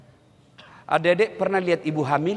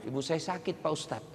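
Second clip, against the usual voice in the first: much softer, low timid excuse; timid student; 3 semitones lower, 10 dB quieter than usual. Retold or imitated. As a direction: say it small and shy. Late student excuses himself.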